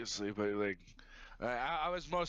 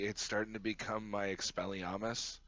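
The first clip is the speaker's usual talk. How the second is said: normal talking voice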